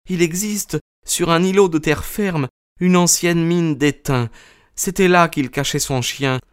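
high-pitched female character voice; high woman's voice, revealing a secret; wronged wife. A narrator's reading. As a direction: answer earnestly in a high voice. Narrator voices Mrs Stapleton.

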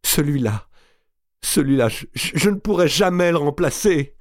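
high, broken, stammering cry; sobbing outcry. A narrator's reading. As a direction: stumble on the words through sobs. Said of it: "cria enfin Marie à travers ses sanglots". (cri entrecoupé de sanglots)

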